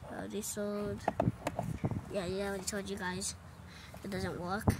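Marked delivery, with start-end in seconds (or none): none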